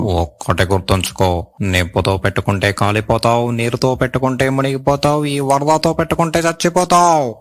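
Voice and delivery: telugu punch dialogue. Pretend. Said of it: "ఇంకో dialogue చెప్పు".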